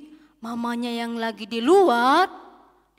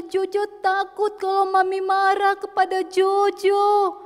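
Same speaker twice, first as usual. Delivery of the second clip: put-on high, slow child's wail; acted pleading cry, words drawn out; tearful apology; 6 semitones higher than usual. A narrator's reading. Jojo confesses the lie to Mami, tearfully begging forgiveness.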